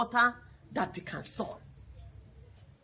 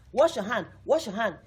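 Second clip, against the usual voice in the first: louder, hammered home like a rule; emphatic command; 6 dB louder than usual. Shown as a demonstration. Teacher urging pupils to keep washing hands, loud and firm. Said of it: "the importance of this water we have been emphasizing".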